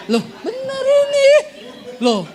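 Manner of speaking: high laughing insistence. Real, not acted. Preacher laughing at the congregation's reaction. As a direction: laugh and insist it's true.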